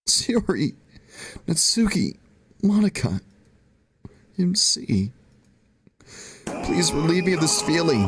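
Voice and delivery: crying. Acted. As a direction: sob out the names, begging for relief. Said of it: "I finally burst into tears".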